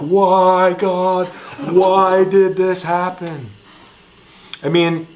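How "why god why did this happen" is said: higher, louder mock cry to the sky, slowly; hammed-up plea to God; mock lament. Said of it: "we're like".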